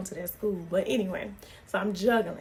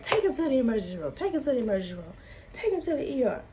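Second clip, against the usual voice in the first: urgent grandmother; 4 semitones higher, faster than usual. Retold or imitated. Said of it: "So she's like".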